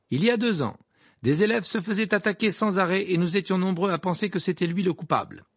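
high-pitched, emphatic press report; sensational newspaper article. A narrator's reading. Rita Skeeter's article about Harry, read out as sensational reporting. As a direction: read it out like a scandalous exclusive.

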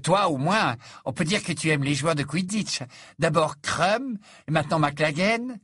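put-on high, eager teasing girl voice; excited gossip. A narrator's reading. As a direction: gush with excitement. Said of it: "surexcitée par cette confidence".

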